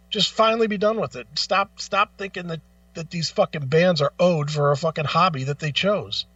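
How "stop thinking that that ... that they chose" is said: normal talking voice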